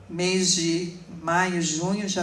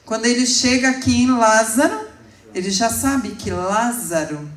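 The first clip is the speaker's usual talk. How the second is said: rising, louder preacher's call on each line; fervent pulpit build, each line louder; preaching build; 4 semitones higher, 6 dB louder than usual. Acted. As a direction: preach it up, climbing with every repetition. Preacher builds on repeated lines.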